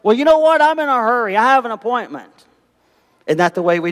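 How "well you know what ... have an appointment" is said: defensive excuse. Retold or imitated. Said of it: "someone in the car says".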